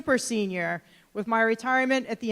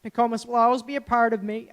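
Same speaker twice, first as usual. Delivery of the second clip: usual speaking voice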